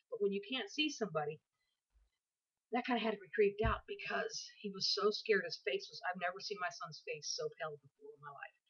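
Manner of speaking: ordinary delivery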